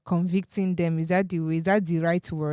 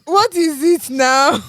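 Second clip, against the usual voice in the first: louder, higher and slow; exasperated; 9 semitones higher, 9 dB louder, slower than usual. Real, not acted. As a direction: drag out the exasperated question. Exasperated teasing question.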